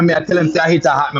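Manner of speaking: normal talking voice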